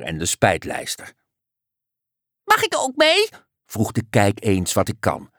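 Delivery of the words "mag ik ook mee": very high, squeaky plea; eager little creature. A narrator's reading. Little creature asking to come along, squeaky. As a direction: pipe up in a tiny hopeful voice.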